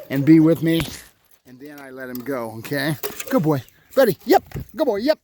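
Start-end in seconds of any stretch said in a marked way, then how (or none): 3.3-5.2 high, bright praise; praising the dog. Real, not acted. Trainer praising his dog as it comes back, high praise. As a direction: cheer the dog on.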